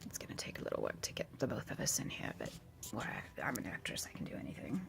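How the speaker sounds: soft breathy whisper to the camera. Real, not acted. Vlogger whispering to her viewers, hushed whisper.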